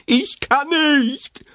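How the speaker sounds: put-on very high, slow, desperate girl's voice; panicked refusal. A narrator's reading. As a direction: Doro refuses in terror at the edge. Doro panicking at the hole. (verzweifelt, sehr hoch und langsam)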